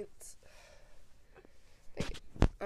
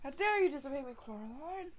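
meme quote; 6 semitones higher, 8 dB quieter than usual. Retold or imitated. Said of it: "I fucking love that meme".

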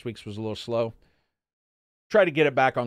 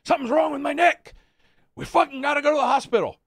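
much higher, louder strained yell; imitating angry dad; 6 dB louder than usual. Son imitating his father demanding the hospital, mock yell. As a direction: bark it like a furious injured old man. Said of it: "And he's like".

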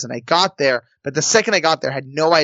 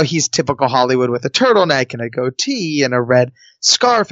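ordinary delivery

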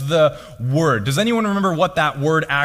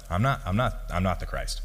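low quiet denial; 8 semitones lower, 7 dB quieter than usual. Retold or imitated. John the Baptist answering the priests, low and quiet. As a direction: drop low and soft, almost mumbled. Preacher voicing John's denial.

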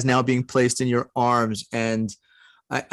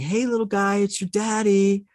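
talking to newborn; 9 semitones higher than usual. Retold or imitated. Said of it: "I just started saying".

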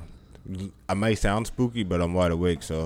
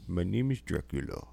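low, soft Russian-tinged Dracula; a lightly done Russian vampire voice; Dracula impression; 3 semitones lower, 8 dB quieter than usual. Friend asks for Dracula, soft low Russian accent. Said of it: "Do your best Dracula impression right now".

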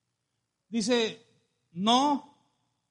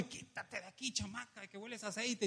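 muted, much softer mock scolding; scolding mother; 15 dB quieter than usual. Mother shoos off the hugging child, mock scolding. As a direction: shoo a child away under your voice. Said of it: "tu mamá te dice".

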